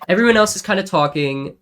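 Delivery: ordinary talk, nothing marked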